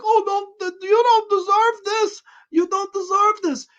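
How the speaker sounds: high, breathy mock of a dumb girl pleading; squeaky airhead plea, played for ridicule; mocking ditzy girl. Reviewer mocking the girl who spares the cannibals, high ditzy voice. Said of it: "big-tittied idiot who comes in the room like".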